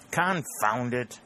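vexed exclamation. Acted. Lieutenant fails to get answers from farmers, vexed outburst. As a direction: spit it out slowly, fed up. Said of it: "in a vexed tone".

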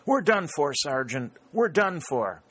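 high-pitched, despairing outcry; panicked soldier crying out in battle; desperate gasp. A narrator's reading. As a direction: gasp it out high, as if all hope is gone. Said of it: "gasped out".

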